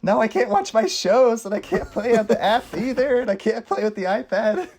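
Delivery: put-on high, slow whining child; crying little girl. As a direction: wail like an upset kid. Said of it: "She's like".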